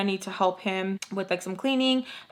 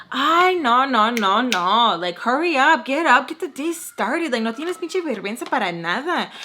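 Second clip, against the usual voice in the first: loud, high mock scolding; impatient nagging; 3 semitones higher, 3 dB louder than usual. Host comes down to the guest on the couch, nagging. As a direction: chivvy them along, pitched up and louder.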